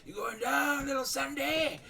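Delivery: high-pitched shouted jeer with a grin; rowdy, grinning trash talk; gleeful taunt. Acted. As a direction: crow it at your opponent. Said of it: "big smile on his face".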